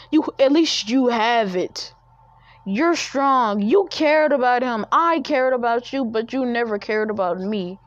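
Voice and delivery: high, loud furious yelling; angry shouting. Acted. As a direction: tear into her, shouting. Naruto banishes Sakura, still yelling.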